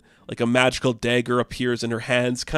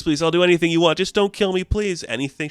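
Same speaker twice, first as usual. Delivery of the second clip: higher and louder pleading for his life; desperate begging; 7 semitones higher, 4 dB louder, faster than usual. Retold or imitated. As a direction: beg frantically on your knees. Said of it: "he gets on his knees begging".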